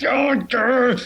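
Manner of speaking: high, hammed-up frustrated grr; mock growl. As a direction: growl at yourself for flubbing the line. Mock frustration at botched take.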